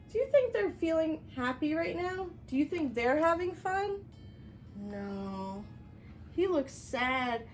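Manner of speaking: ordinary delivery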